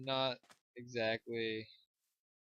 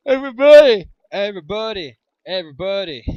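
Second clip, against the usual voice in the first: fading call; 7 semitones higher, 23 dB louder than usual. Pretend. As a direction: call out, then fade down. Calling out, fading each time.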